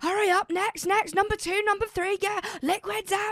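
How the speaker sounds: high-pitched barked orders, imitated; mock airport shouting. Mimicking Gatwick security yelling at the queue. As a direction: play the pushy security guard yelling. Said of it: "like, shouting".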